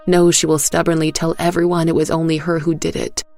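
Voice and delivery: plain reading voice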